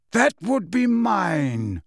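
ordinary delivery, nothing marked

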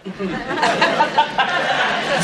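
high teasing cackle; teasing laugh. Acted. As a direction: laugh at him mockingly. Vanessa laughs at Theo.

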